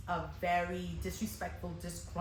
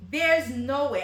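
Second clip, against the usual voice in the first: "There's no way" said loud and high, sure of herself; firm, loud denial with full confidence; loud disbelief; 6 semitones higher, 10 dB louder, slower than usual. Retold or imitated. Stylist telling herself the hair can't be loose, loud disbelief. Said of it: "And I'm like".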